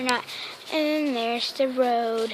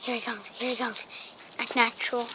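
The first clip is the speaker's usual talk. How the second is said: softer, hushed aside; 9 dB quieter than usual. Real, not acted. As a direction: keep your voice down so he won't notice. Aside while dog approaches.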